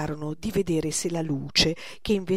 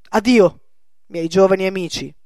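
loud cold farewell; 10 dB louder than usual. Acted.

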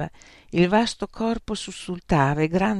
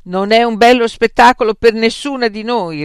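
loud, higher retort; sharp retort; 6 semitones higher, 12 dB louder than usual. A narrator's reading. Frank snapping back at Beatrice, loud. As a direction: fire it back at her. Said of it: "ribatté Frank".

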